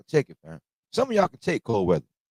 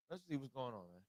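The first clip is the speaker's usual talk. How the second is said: ordinary talk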